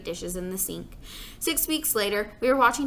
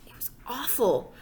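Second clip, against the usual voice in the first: whispered confession, no voice. Acted. Narrator admitting the omelet was awful, whispered. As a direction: breathe it out like a secret.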